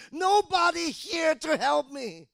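high, loud, slow self-pitying wail; mock self-pity. Preacher mocking a self-pitying believer, slow loud wail. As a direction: drag it out like a pitiful complainer. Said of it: "and say".

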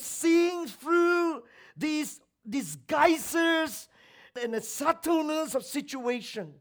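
high, loud, full-force sermon delivery; ringing, emphatic pulpit voice; impassioned preaching. Real, not acted. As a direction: preach it out, pounding each phrase.

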